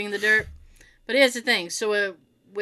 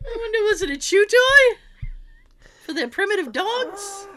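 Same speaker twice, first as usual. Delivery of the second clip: pondering archaeologist; 8 semitones higher than usual. Pretend. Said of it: "they'll be like".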